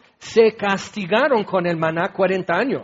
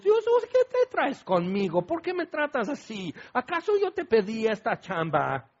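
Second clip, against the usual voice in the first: high, whining and softer; whiny Moses; 3 semitones higher, 6 dB quieter than usual. Acted. Moses grumbling to God about his job, high whine. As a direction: complain like an overworked employee. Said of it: "lo quejumbroso".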